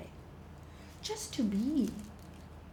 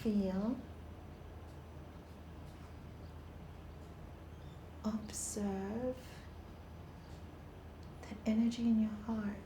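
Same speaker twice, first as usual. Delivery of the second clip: guided meditation; 3 semitones lower, 10 dB quieter than usual. Acted. Teacher guiding listeners through breathing, softly. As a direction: lead them gently into stillness.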